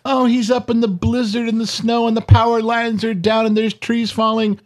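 high, fretting imitation of a worried daughter; mock panicked daughter. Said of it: "And this daughter calls you".

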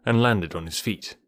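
ordinary delivery

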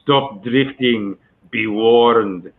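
lightly imitated Middle Eastern accent; measured warning with a hint of Middle Eastern accent; Omar Sharif. Actor quoting the film line that gave him his accent, Middle Eastern accent. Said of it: "that's my accent".

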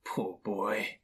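soft, sorrowful murmur; pitying. A narrator's reading. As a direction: say it gently, grieving for a lost child. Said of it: "he said in pity for his former self".

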